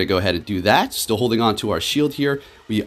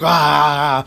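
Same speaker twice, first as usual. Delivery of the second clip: loud, high "ah" imitating a furious yell; retold rage scream; 7 semitones higher, 6 dB louder than usual. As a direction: re-enact jumping up in a rage with a drawn-out yell. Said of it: "I jumped up in a rage".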